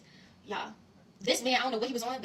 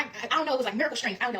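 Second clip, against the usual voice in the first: ordinary talk, nothing marked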